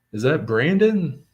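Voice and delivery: retold hail from a short way off; imitated call-out. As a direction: call out like you've just spotted someone.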